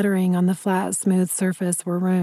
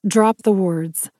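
ordinary delivery

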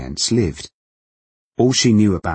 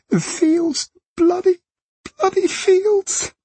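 very high, slow sobbing scream; screaming and crying; slower than usual. A narrator's reading. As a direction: wail it out in hysterics. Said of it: "She began to scream and cry at once".

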